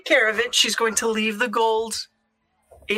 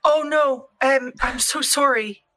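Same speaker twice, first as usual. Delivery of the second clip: ordinary talk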